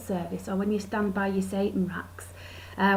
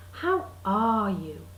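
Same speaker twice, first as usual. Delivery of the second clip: slow "How ARE you", long weighted ARE; stress on ARE; 2 semitones lower, much slower than usual. Retold or imitated. Greeting someone after an absence, slow stress on ARE. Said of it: "that emphasis in the middle".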